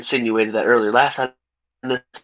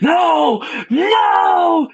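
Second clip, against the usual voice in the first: much higher, louder crying shout of no; anguished cry; 3 dB louder than usual. Retold or imitated. Reviewer acts the villain's breakdown with a high anguished cry. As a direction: wail it like a broken villain. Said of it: "And he's like".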